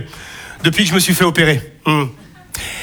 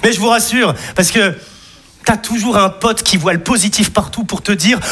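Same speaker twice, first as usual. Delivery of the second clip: ordinary delivery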